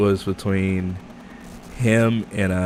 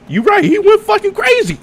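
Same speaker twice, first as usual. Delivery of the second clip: much higher, louder hyped exclaim; hyped high yell; 6 dB louder than usual. Real, not acted. Host reacting to a wild anime fight, high hyped yell. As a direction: burst out in hype.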